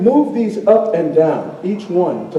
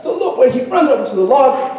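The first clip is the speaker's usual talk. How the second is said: ordinary delivery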